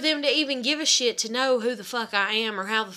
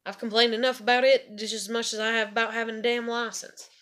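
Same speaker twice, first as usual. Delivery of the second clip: usual speaking voice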